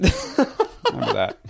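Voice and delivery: slow, laughing high aside. Real, not acted. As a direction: chuckle it out slowly. Laughs at own joke.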